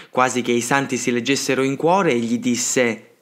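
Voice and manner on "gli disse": plain reading voice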